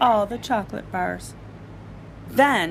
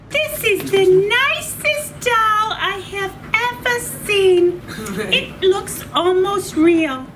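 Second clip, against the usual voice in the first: much higher, louder delighted voice; admiring store lady; 9 semitones higher, 4 dB louder than usual. Acted. Store lady admires Taya as a doll, high and delighted. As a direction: coo over a doll.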